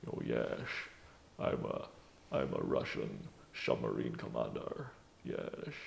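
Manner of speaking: very low, soft Scottish drawl passed off as Russian; deep, slushy Scottish star impression; Sean Connery doing Russian. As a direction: play a Russian commander in an unchanged deep Scots voice. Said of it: "Sean Connery doing Russian".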